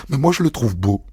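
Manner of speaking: usual speaking voice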